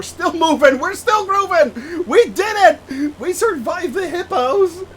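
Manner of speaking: high, loud, half-laughing fake tears; overjoyed mock sobbing over a safe flight; mock emotional. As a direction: ham up teary joy at surviving. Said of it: "I'm getting all emotional here".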